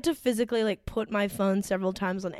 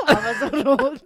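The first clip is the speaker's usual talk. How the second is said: laughing; 5 semitones higher, 4 dB louder than usual. Real, not acted. Swooning over actor.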